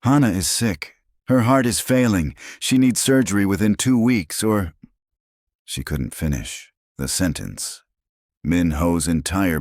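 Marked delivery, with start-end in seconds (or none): none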